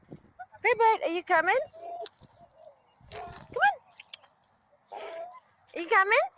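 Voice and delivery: high, sing-song call to the dog. Real, not acted. Owner calling her dog over, high and coaxing.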